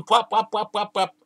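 high, rapid-fire smacking noises; imitated blows. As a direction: voice a string of repeated whacks. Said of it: "grabs the lion and hits him".

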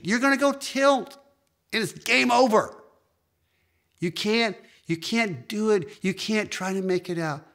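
high, loud, fervent preacher's cry; preaching at full force. Acted. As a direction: drive it home to the congregation. Pinball tilt warning preached.

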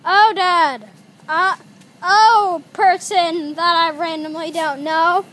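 loud, stumbling excuse; loud, caught-out protest; flustered explaining. Acted. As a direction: raise the voice and fumble for words. Caught, explaining loudly to owner.

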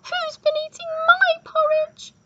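very high, loud little squeak; squeaky baby bear. A narrator's reading. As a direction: squeak it like a tiny bear cub. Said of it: "squeaked Baby Bear".

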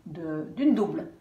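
usual speaking voice

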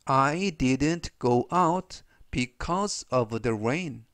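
very slow, clear sentence read for repeating; slow model reading. Shown as a demonstration. Teacher reading slowly for students to repeat. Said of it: "제가 역시 천천히 세 번씩 읽어드리고요".

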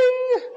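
high bell ding; high ding chime. Retold or imitated. Ding sound effect.

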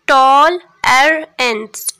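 टॉल, एर, एंस spoken slowly as separate beats; syllable by syllable. Shown as a demonstration. Teacher dividing a word into syllables for class, slow split. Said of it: "टॉलरेंस में number of syllable देख लें".

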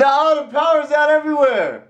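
high fake panic about the outage; fake alarm. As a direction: keep the fake panic going. Continues the prank.

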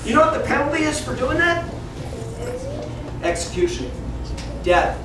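ordinary talk, nothing marked

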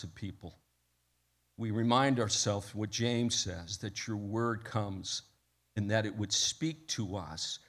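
hushed pastoral prayer, voice dropping at phrase ends. Real, not acted. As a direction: address God quietly and earnestly. Leads the congregation in prayer.

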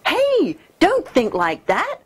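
high mock scold to a baby. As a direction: chide it like a fussy child. Addressing the primitive brain.

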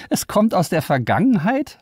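mock the idea of a past signal. Liara mocks the AI about the past, scoffing. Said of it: "mit spöttischem Ton".

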